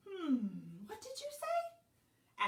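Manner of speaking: high, slow questioning hum; puzzled librarian. A narrator's reading. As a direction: ask slowly in a high librarian voice.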